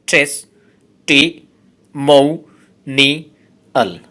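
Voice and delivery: Testimonial said as slow, separate syllables; teacher dividing testimonial into syllables. Shown as a demonstration. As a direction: spell out testimonial one syllable at a time. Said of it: "এই ওয়ার্ডটাতে আছে পাঁচটি syllable".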